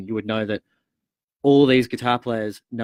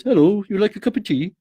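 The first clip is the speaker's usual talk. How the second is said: put-on Welsh accent; 9 semitones higher, 6 dB louder than usual. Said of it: "he's got this Welsh accent".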